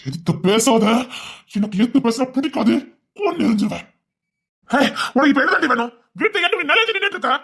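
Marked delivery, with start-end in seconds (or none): 3.2-7.3 high, strained furious scolding; angry scolding. Acted. Brother berating his sister in the quarrel, strained furious yell. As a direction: rage at her, voice climbing high.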